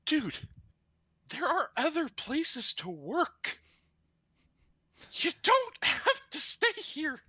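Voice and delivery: high, slow exasperated plea; incredulous urging. Retold or imitated. Applicant urges the manager to work elsewhere, exasperated. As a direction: spell it out slowly, high-pitched and pleading.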